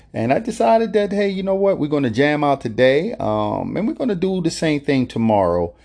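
high, bright burst of excitement; excited hype. Real, not acted. Host hyping listeners for today's jam session, excited and high. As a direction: pump everyone up about the jam session.

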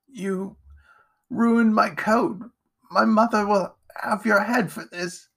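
high, strained, halting reproach; wounded gasping. A narrator's reading. Edgar, stabbed, blames Jack in a pained voice. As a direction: force it out haltingly, in pain.

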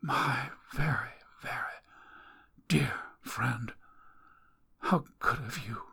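barely able to speak; weaker dying woman. Acted. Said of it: "the voice was weaker".

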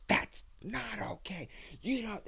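high, soft prim mom voice; imitating a mom. Offended mom scolding at practice, mock high voice. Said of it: "Sam's mom goes".

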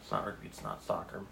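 muttering to self. Real, not acted. Muttering repeated guesses to self.

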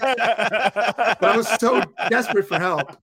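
slow, high, laughing through the words; real laughter. Real, not acted. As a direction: laugh while admitting it.

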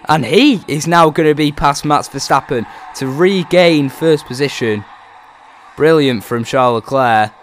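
loud, high excited race call; excited commentary. Acted. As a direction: shout the re-pass with excitement.

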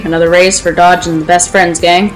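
ordinary talk

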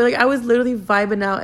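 usual speaking voice

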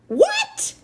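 very high-pitched, loud screech. A narrator's reading. Amanda can't believe Maniac's question, outraged screech. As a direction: shoot right up into an outraged screech. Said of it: "she screeched".

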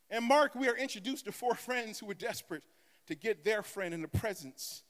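ordinary delivery

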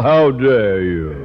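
slow, outraged rebuke; indignant. Acted. Principal to the teacher blackmailing him, slow indignant. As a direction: drag out the indignation.

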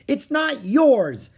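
high, slow indignant yell; shouting. A narrator's reading. Annie to the thieving pirate, indignant shout. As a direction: shout each word in protest. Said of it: "shouted Annie".

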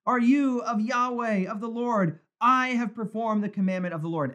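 a hint of a boastful king; Saul exclaiming. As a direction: play a smug Saul, pleased with himself. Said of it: "Saul exclaims".

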